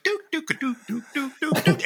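repeated high dunk noises; imitated clink sound. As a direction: mimic a pedal clink over and over. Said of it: "I'm just like".

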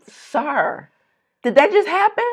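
high, laughing disbelief; a hammed-up, shocked question; stunned disbelief. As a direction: ask in shocked amazement. Stunned retelling of outburst.

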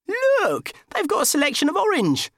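very high, loud delighted cry; gleeful. Acted. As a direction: point it out with childish glee. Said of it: "he grinned".

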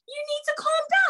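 very high-pitched mock order; agitated retelling. Retold order to calm down, heightened.

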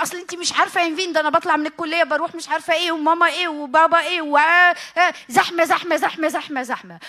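fast, loud, breathless list of chores; harried rant. Acted. Busy student ranting to Nevine, loud and fast. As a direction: rattle it off like an overwhelmed student.

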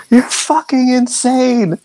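high, slow outraged holler; drawn-out high-pitched scream of disbelief; hollering navigator. Acted. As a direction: holler at a reckless pilot. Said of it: "your navigator is just hollering".

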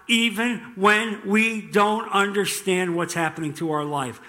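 ordinary delivery

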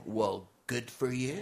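slow, dismissive snob; snooty local. Retold or imitated. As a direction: drag it out with lofty disdain. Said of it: "People in San Francisco will go".

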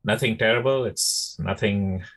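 usual speaking voice

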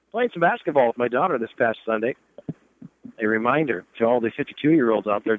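normal talking voice

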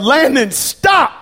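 high, loud urgent shout; yell. Retold or imitated. Dad yelling at his son running across a parking lot, urgent yell. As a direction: yell it to halt a child in his tracks. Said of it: "I yelled".

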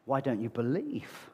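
Jesus asks his disciples after the storm, soft and low; soft rebuke. Retold or imitated. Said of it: "And then he says to his disciples".

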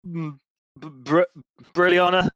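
high groggy hungover groaning. Retold or imitated. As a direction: drag the name out groggily. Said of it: "she's so hungover".

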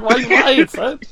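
high, loud mock protest; mock indignant. Host voicing the other guy caught out, mock indignant. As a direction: imitate an outraged protest, laughing at the end. Retold indignant quote, teasing.

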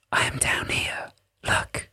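slow, soft whispering voice; hushed tiny voice; whispering fairy voice. A narrator's reading.

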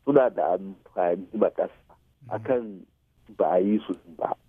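usual speaking voice

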